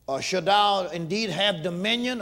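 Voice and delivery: loud, slow scripture preaching. Acted. Preacher proclaiming Joseph's dream, loud and slow.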